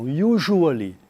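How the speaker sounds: Usually with a zh sound, not s, YOO-zhoo-uh-lee. Shown as a demonstration. Teacher corrects usually, zh not s. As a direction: say Usually with zh, never s. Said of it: "Não é o S, não".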